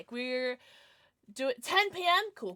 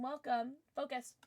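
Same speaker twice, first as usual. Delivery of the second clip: usual speaking voice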